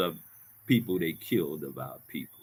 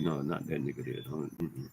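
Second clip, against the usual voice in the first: imitated warning; 8 semitones lower, 11 dB quieter than usual. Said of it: "They be like".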